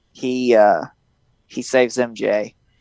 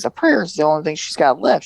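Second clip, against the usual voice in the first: normal talking voice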